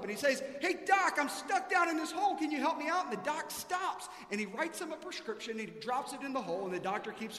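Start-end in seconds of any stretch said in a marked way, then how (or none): 0.6-3.0 loud, much higher call for help; yelling up. Retold or imitated. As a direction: shout up from the bottom of a hole. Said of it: "the guy yells up".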